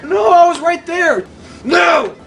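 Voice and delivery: high, loud protest at a near miss; dismayed shout. Real, not acted. Player who was right there, shouting in dismay. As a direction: cry out in frustration.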